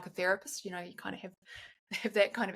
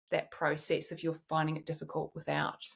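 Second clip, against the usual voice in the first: ordinary talk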